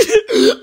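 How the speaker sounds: short burst of high laughter; a quick real laugh breaking out; sudden high laugh. Real, not acted. Laughs at his own fear.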